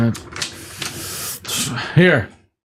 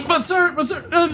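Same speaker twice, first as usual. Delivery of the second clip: high, stammered protest; panicked pleading. Acted. Fired employee pleading with his boss, panicked. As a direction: beg the boss to wait.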